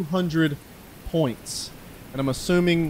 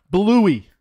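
louder, higher callout of the name; excited callout; 5 semitones higher, 7 dB louder than usual. Real, not acted. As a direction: hit the name again with more excitement. Name repeated, bigger.

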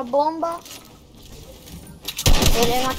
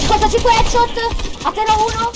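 excited shout; 9 semitones higher than usual. Real, not acted. Celebrating a headshot kill.

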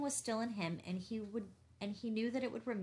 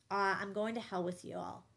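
ordinary delivery

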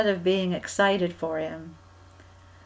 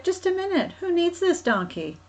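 high-pitched challenge from the owner; high, put-on voice of a puzzled owner; questioning donkey owner; 9 semitones higher than usual. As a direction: stop them and ask, voice pitched well up.

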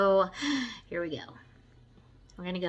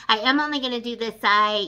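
ordinary talk

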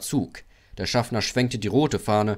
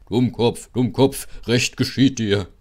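loud jeer, slightly raised pitch; taunting call; 2 semitones higher, 5 dB louder than usual. A narrator's reading. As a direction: call it out mockingly, punching each phrase. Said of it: "Und Gordon rief". (laut und spöttisch gerufen)

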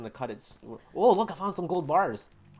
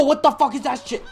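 startled shout; 9 semitones higher, 10 dB louder than usual. Real, not acted. Startled by something in game.

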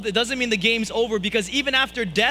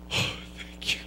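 whispered thanks; 7 dB quieter, slower than usual. Retold or imitated. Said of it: "I'm like".

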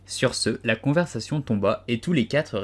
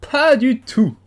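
much higher, louder exclaimed protest; the Hatter cries out in loud denial; exclaiming; 11 dB louder, slower than usual. A narrator's reading. The Hatter contradicts Alice with a loud high cry. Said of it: "s'exclama le chapelier".

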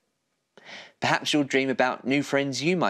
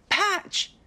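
even higher, rising call across the park; second call, pitched way up, rising at the end; calling louder. Acted. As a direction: call again, more urgent and higher. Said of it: "Oliver called".

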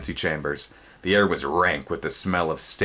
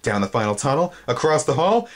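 ordinary delivery, nothing marked

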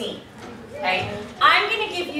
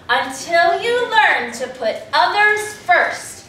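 slow, loud, higher incantation; fates casting a curse; 5 semitones higher, 5 dB louder, much slower than usual. Shown as a demonstration. Fates cursing the genie, slow raised incantation. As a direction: pronounce it like a spell, slowly. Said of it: "They say the cue is".

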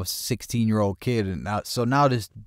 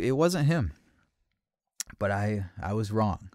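usual speaking voice

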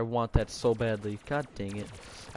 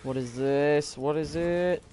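ordinary delivery

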